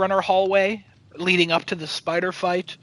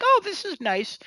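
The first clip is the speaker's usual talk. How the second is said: mock delight; 12 semitones higher than usual. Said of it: "and it's like".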